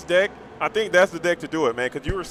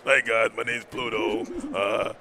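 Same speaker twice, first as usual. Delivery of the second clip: lower, easygoing cartoon dog introducing himself; Pluto voice; 6 semitones lower than usual. Debuting the new voice of Pluto for the stream, low and casual. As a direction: drop low and say hi like a regular guy. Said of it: "let me introduce you to the new voice of Pluto".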